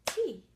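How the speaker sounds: tea said loud, set apart; loud teacher emphasis on tea; stressed example word. Shown as a demonstration. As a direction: lean on tea for the class. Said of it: "and we have".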